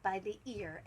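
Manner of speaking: usual speaking voice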